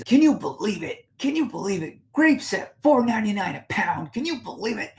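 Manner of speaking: high, loud put-on voice of an indignant woman; outraged shopper. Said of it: "And she's".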